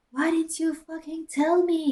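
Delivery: high, loud hammed-up yell; mock shout. Draco shouts at Ebony, a hammed-up high yell. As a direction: yell it like a melodramatic goth boy. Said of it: "he shouted".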